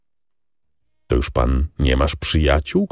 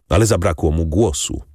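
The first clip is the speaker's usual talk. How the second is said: ordinary delivery, nothing marked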